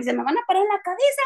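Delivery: high, fast mock panic; high hurried alarm; panicky. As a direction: blurt it out in a rush of fright. Retold panic as birds circle.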